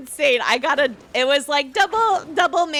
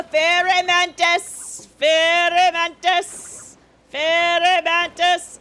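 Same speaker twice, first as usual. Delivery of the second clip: drawn-out call; 3 semitones higher, 4 dB louder, slower than usual. Real, not acted. Calling out a bug spawn, drawn out.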